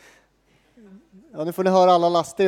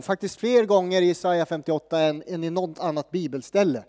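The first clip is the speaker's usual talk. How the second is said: normal talking voice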